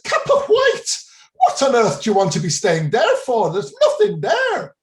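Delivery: incredulous mock Irish outburst, very high and loud; terrible Irish accent. Tea-room woman astonished by tourists' village, incredulous mock Irish accent. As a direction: ham up an Irish barmaid's loud disbelief. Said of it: "forgive the accent, I know it's terrible".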